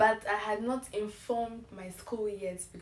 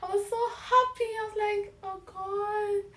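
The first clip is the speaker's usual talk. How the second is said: higher, fast and breathless with happiness; giddy joy; 11 semitones higher than usual. Retold or imitated. Student relives the joy of her scholarship, giddy and high. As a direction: gush it out.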